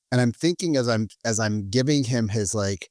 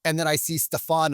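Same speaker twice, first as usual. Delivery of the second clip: normal talking voice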